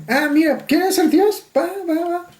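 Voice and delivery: God teasing, offering the job lightly; voz de Dios. Acted. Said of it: "le dice". (Dios hablándole a Jim Carrey, en tono juguetón y agudo)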